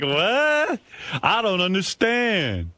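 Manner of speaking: high, slow confused what; mock bafflement. Host mimicking a baffled Richard, mock confused. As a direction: feign total confusion. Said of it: "it's like".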